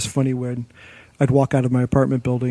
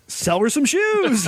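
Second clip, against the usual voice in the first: boss's order; 12 semitones higher, slower than usual. Retold or imitated. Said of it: "And our boss is like".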